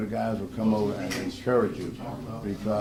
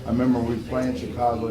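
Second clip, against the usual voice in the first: ordinary talk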